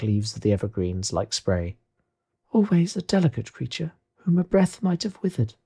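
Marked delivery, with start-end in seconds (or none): 2.5-5.5 high, otherworldly ghost voice. Acted. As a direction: speak as a spirit, pitched well above normal. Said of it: "said the ghost".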